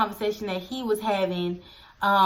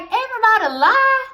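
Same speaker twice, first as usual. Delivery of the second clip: high, loud incredulous question; incredulous protest; 11 semitones higher, 5 dB louder than usual. Retold or imitated. To the aunt, a high, loud incredulous protest. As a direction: squeak it out in disbelief. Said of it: "I'm just like".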